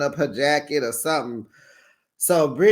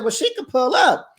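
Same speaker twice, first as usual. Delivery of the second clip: high, louder challenging; heated retort; 8 semitones higher, 4 dB louder than usual. Retold or imitated. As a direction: dare her, raised voice. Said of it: "She said".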